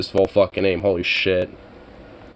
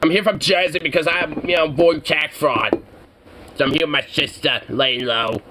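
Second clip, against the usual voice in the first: put-on Jersey accent; 5 semitones higher than usual. Streamer's Angelo explains hiding out, in a Jersey accent. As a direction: sell the tax-fraud excuse like a slimy Jersey crook. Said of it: "Jersey-ish accent".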